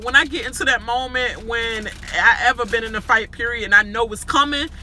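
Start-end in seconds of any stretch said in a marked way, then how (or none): none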